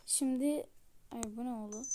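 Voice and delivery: usual speaking voice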